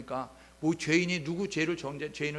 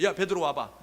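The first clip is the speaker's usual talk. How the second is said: mock scolding; 4 semitones higher, 6 dB louder than usual. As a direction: roll up your sleeves and berate him. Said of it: "손매 걷어붙이고". (소매 걷어붙이고 베드로를 크게 꾸짖듯)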